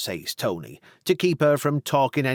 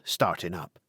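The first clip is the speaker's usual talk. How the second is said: plain reading voice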